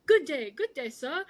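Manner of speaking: a hammed-up British accent, lightly done; mocking British accent. Retelling George's radio reply to Toto, in a light mock British accent. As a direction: imitate George doing a plummy British "good day, sir". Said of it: "almost a mocking British accent".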